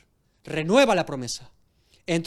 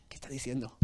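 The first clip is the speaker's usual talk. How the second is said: whispered to herself behind the tent; hushed, astonished whisper; secret whisper; 5 semitones lower, 13 dB quieter than usual. Acted. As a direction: whisper it so the guests can't hear. Sara eavesdropping behind the tent.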